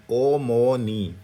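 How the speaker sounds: ओ मो नी said very slowly; slow blended. Shown as a demonstration. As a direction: stretch ओ मो नी out. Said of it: "उसके बाद नी".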